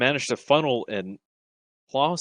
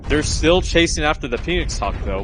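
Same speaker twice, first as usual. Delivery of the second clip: raised, urgent play-by-play call; esports caster; 2 semitones higher, 3 dB louder than usual. Real, not acted. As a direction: call the fight live, pushing up. Live match play-by-play.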